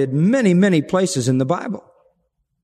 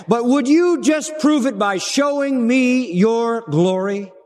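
higher, slow, pushy plea for proof; brash Moses; 6 semitones higher, slower than usual. Acted. Moses brashly asking God for proof, higher and slow. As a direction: lean in and press God, half cheeky.

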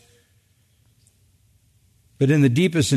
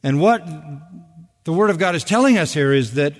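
ordinary talk

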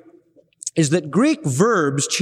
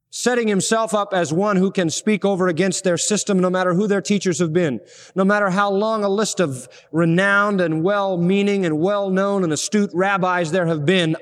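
ordinary talk

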